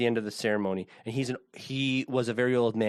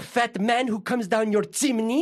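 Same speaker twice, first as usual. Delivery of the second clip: put-on slow, high old Greek man voice; imitating an elderly Greek bishop, slow; Greek accent; 8 semitones higher, 3 dB louder, slower than usual. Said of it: "this is in a Greek accent".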